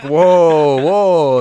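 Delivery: loud, drawn-out awed whoa; mock amazement, loud and high; amazed. Streamer's viewers amazed by him.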